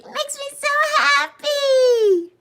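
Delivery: very high, squeaky delight; happy squeak. Real, not acted. Streamer happy Bev is here, squeaky delight.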